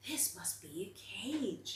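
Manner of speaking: soft, slow unvoiced whisper; whispered worry. A narrator's reading. Bear peeking out of basket, fearful whisper. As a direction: hush it as if peeking through bars. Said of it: "peeking through the bars".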